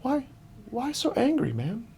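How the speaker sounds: soft, high-pitched tender plea; gentle asking. Retold or imitated. Runner imagining a nice chat with angry kid, gently asking. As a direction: coax an upset person gently. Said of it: "just ask him gently".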